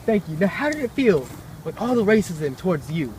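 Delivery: eager reporter. Acted. Reporter thanking Owens, eagerly high. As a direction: gush at the answer.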